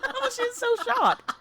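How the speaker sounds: laughing, slow and high. Real, not acted. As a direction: crack up while saying it. Host reacts laughing.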